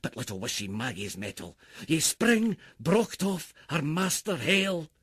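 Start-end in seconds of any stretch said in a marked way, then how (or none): none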